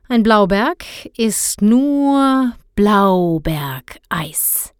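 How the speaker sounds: langsam gedehnter Papawitz; witzelnd. A narrator's reading. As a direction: die Pointe genüsslich in die Länge ziehen. Vater macht Witz, wartet auf Lacher. (scherzhaft gedehnt, auf einen Lacher aus)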